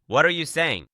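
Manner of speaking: a touch of indignant anger; angry. A narrator's reading. Hyuk challenges the hero's choice, mildly angry. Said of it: "Hyuk gets angry and says".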